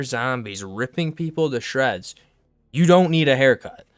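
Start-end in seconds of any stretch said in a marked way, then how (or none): 2.7-3.9 loud, incredulous yell; exasperated shout. Real, not acted. Ranting at pandemic protesters wanting haircuts, loud and exasperated. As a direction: shout it at them in disbelief.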